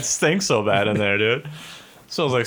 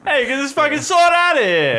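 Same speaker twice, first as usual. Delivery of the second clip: much higher, louder shouted impression; Ray Liotta; 4 dB louder than usual. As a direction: bark it like a hammed-up Ray Liotta. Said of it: "Impression Cast. We are here with Ray Liotta".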